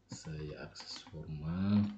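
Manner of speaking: slow, near-whispered mumble; drawn-out, very soft self-talk; muttering to self. Real, not acted. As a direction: think aloud under your breath as you click. Talking to self while clicking.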